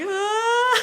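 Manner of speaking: high, loud mock wail; hammed-up cry of a freaked-out kid; imitated child's scream. Mom imitating her frightened kids, high mock cry. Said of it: "they're like".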